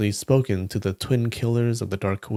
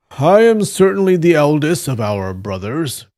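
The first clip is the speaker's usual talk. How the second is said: higher, louder declamatory voice; humble eldest brother; 5 semitones higher, 5 dB louder than usual. A narrator's reading. Eldest Taoist answers his junior, declaiming humbly.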